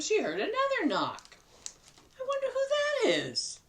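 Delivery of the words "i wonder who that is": higher, cheerful grandmother voice; grandma Bubba. Acted. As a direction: play the kindly grandma talking to her guest. Bubba wonders aloud.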